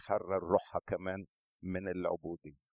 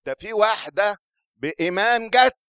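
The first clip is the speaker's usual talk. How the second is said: higher and much louder preaching burst; emphatic shout; 10 semitones higher, 11 dB louder than usual. Real, not acted. Preacher to congregation, emphatic shout. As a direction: lift it to a shout.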